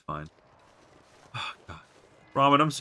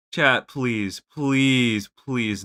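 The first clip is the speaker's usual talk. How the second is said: usual speaking voice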